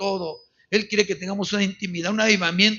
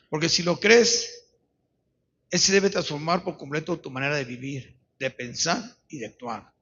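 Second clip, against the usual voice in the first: lower, measured appeal with long pauses; solemn altar call; 4 semitones lower than usual. Real, not acted. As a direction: invite them gravely to search their hearts. Solemn closing appeal to congregation.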